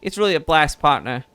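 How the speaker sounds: lightly southern reporter voice, easygoing; southern accent. Acted. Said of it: "now it's going really southern".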